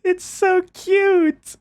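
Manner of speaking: high, drawn-out gushing squeal. Real, not acted. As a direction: stretch it out high, melting over the cuteness. Gushing over a friend's cute clip.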